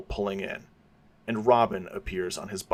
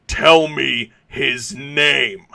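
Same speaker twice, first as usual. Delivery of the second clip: slow, much louder furious yell; 4 semitones higher, 10 dB louder, much slower than usual. Acted. Bruce yelling at Carmine for the name, slow furious yell. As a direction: drag each word out in rage. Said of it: "but Bruce yells again".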